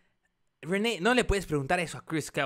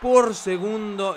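wrestling commentator; 7 semitones higher, 4 dB louder than usual. Acted.

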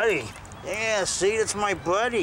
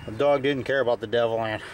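usual speaking voice

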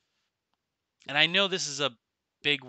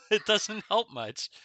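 higher laughing repeat; 10 semitones higher, 7 dB louder than usual. Real, not acted.

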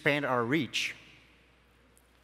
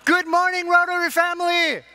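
much higher and louder, full energy; bigger greeting; 13 semitones higher, 8 dB louder than usual. Real, not acted. Speaker repeats the greeting so the audience answers, very loud and high. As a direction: really sell the hello this time. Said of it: "We can do that one more time".

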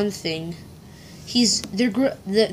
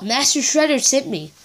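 normal talking voice

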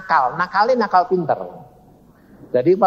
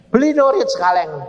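high-pitched, emphatic command; God; 7 semitones higher than usual. Retold or imitated. God answering the wife's prayer, commanding. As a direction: pronounce it as a ringing order. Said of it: "Tuhan ngomong gini".

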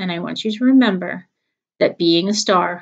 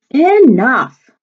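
louder, sharp shout of enough; loud growl; 5 semitones higher, 9 dB louder than usual. Acted. Dad shouting to stop the kids fighting. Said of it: "Dad growled loudly".

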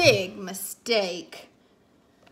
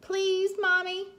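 put-on crying-out child plea; 7 semitones higher than usual. A narrator's reading. Cassie begs Mommy to keep the dog, pleading. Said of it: "Cassie cried".

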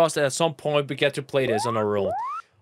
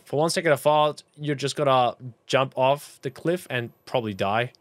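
usual speaking voice

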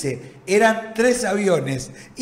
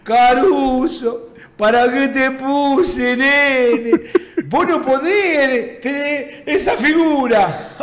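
slow, drawn-out coach impression; Trigili; 4 semitones higher, much slower than usual. Coach scolding Caruso for his figure, slow drawling imitation. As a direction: drag every word out in exasperation. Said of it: "porque hablaba así el".

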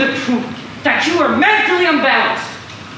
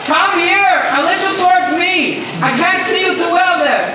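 calling out; 5 semitones higher than usual. Real, not acted. Calling to man in water.

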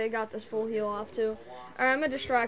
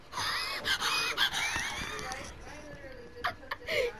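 shrieking laugh; 4 dB quieter than usual. Real, not acted. Invisible killer glitch.

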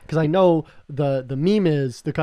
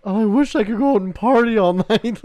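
higher, louder wistful wish with a laugh; 7 semitones higher, 7 dB louder than usual. Retold or imitated. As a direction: sigh it longingly, laughing. Own wistful reply, laughing.

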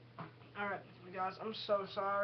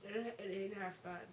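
eating gross jelly beans; 5 dB quieter than usual. Real, not acted. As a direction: mouth full of toothpaste bean. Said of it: "chew it and swallow it".

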